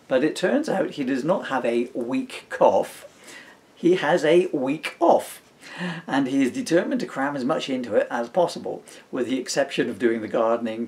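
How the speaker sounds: put-on storybook reading in the baby's diary voice; reading aloud. Dad reading his book to his son, lightly voiced story reading. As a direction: read the diary entry out with a dry wink.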